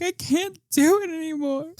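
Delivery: high, whimpering mock despair; mock crying mom. Mom in the corner crying to the nanny, mock whimper. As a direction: play the beaten-down mom, half-sobbing. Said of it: "She's like".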